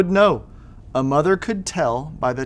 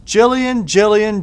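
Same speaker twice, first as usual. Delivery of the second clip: high sing-song call of a name, a bit louder; mother calling out; 6 semitones higher, 4 dB louder, slower than usual. Acted. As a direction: call her child from down the hall. Mother calls daughter in story.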